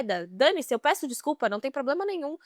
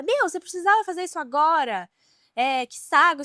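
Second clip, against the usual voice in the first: raised-pitch retelling of annoyed scolding; mock angry; 5 semitones higher, 4 dB louder than usual. Imitating her sister complaining about the noise, mock angry. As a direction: imitate someone snapping in irritation. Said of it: "ela ficou muito brava".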